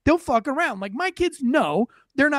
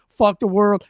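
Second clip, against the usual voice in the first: mock defiance; 6 dB louder than usual. Mock attitude of jaywalker.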